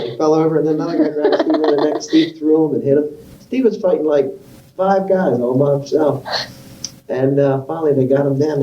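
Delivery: ordinary talk, nothing marked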